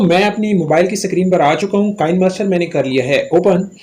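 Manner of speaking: ordinary talk, nothing marked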